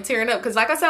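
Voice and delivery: ordinary talk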